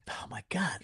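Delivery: breathy whisper, barely voiced, to himself; whispered oh my God. Patient in the scanner whispering to himself in fear. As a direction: whisper it in private dread. Panicking inside during cat scan.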